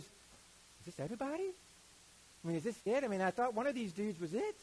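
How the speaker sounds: asking, baffled, if that's all; puzzled Samuel. Retold or imitated. Samuel asking the father if that's everyone, baffled.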